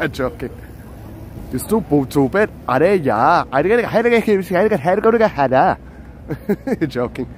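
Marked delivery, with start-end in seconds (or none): 1.5-3.4 mock-indignant Indian accent; funny Indian accent. Teasing Patrick for laughing, funny Indian accent. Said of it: "'cause of my accent". 3.5-5.7 put-on rapid-fire nonsense babble in an Indian accent; mock scolding gibberish. Scolding Patrick as a joke, mock Indian gibberish.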